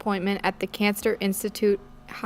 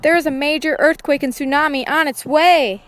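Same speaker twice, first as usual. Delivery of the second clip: scream; 6 semitones higher, 4 dB louder than usual. A narrator's reading. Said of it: "runs into the room and screams".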